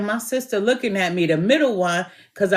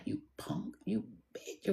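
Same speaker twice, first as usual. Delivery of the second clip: imitating a sister's under-the-breath jab; secretive, barely audible taunt; hushed insult; 14 dB quieter than usual. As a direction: say it very softly, on the sly.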